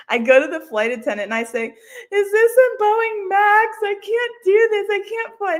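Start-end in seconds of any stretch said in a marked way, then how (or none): 1.7-5.6 high, loud tearful plea; crying panic. Retold or imitated. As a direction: sob out the question in a panic. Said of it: "tears start streaming down my face".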